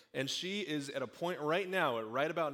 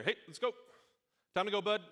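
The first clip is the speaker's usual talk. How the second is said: higher imitated dad calling his kid from the car; 7 semitones higher, faster than usual. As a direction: call out to your kid after school, lightly mock-dad. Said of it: "that's my dad calling me".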